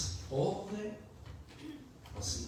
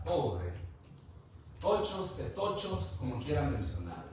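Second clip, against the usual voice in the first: usual speaking voice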